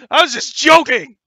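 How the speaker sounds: loud, high-pitched shout; mock protest. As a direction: blurt it out loudly in mock protest. Mock protest amid table banter.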